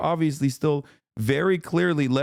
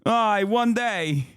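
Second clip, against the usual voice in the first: high, slow broken-English voice of a sad wannabe husband; mocking broken English; 8 semitones higher, slower than usual. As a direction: ham it up as a pathetic foreigner dreaming of a wife. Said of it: "He's like".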